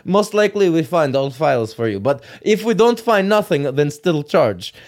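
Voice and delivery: put-on Russian accent. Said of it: "talking to some Russian guy who's just like".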